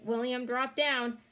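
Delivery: plain reading voice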